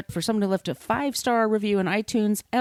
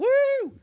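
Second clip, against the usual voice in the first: high excited whoop; high cheering woo. Real, not acted. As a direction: cheer like a crowd.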